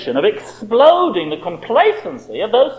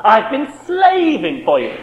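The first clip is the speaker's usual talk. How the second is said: petulant whine; 6 semitones higher than usual. Retold or imitated. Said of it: "Can't you hear the petulance in that?".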